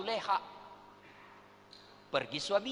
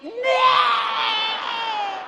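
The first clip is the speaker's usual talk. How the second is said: high wailing shriek; high mock wail; shrieking outcry; 11 dB louder than usual. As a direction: let out a mock crying shriek. Mocking outburst.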